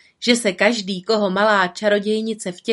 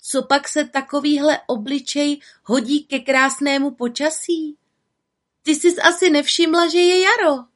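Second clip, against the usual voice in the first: put-on higher, louder coaxing girl voice; cheerful little witch; 7 semitones higher, 3 dB louder than usual. Little witch coaxes the sad woman, cheerfully. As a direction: try to cheer her up.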